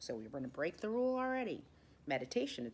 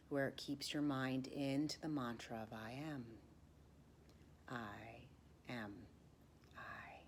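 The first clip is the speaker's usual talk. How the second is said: whispered mantra meditation; 3 semitones lower, 7 dB quieter than usual. Acted. Teacher leading the class in the I am mantra, hushed whisper. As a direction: lull them into the mantra.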